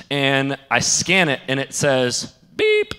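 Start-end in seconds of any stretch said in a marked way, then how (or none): 2.6-2.9 very high electronic beep; imitated scanner beep. Ticket gate rejecting his ticket, high mock beep. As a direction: squeak it like a scanner.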